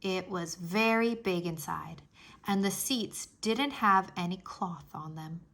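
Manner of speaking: ordinary delivery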